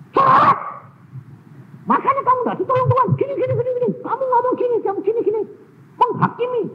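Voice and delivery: very high, loud shrill scolding; scolding rant. Retold or imitated. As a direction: tell her off sharply. Scolding chatty woman. (omelan keras bernada tinggi)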